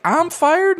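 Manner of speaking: very high incredulous question; shocked disbelief. Retold or imitated. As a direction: gasp it out in disbelief. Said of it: "She's like".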